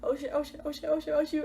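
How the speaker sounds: mock panicked oh-no on a loop; retold panic. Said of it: "I'm panicking".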